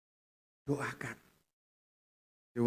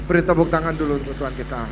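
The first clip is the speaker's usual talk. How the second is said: loud, raised rally to clap; calling for applause; 5 semitones higher, 8 dB louder, faster than usual. Real, not acted. Pastor calling the congregation to applaud God. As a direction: rouse the crowd to clap for God.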